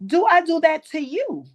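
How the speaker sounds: mother questioning her daughter about hitting the doll; stern mother. Retold or imitated. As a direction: press the child for an answer. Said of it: "she said".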